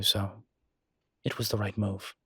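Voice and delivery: ordinary talk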